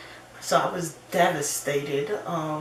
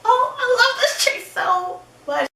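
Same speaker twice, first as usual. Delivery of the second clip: much higher, louder, laughing with delight; gushing; 6 dB louder than usual. Real, not acted. Gushing about her best friend April. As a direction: gush with love for her friend.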